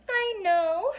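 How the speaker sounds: high tiny squeak; small squeaky fairy voice. A narrator's reading. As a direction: squeak it out, close to tears. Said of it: "she squeaked".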